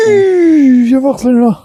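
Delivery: calling out loudly to someone far off; high, loud shout across the garage; yelling across the room. Real, not acted. Calls someone over to see. (crier à travers la pièce)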